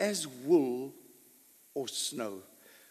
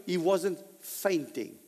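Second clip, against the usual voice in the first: ordinary delivery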